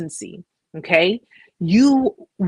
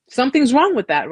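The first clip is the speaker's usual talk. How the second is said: emphatic and louder; 5 semitones higher, 5 dB louder than usual. Real, not acted. Repeated emphatic point.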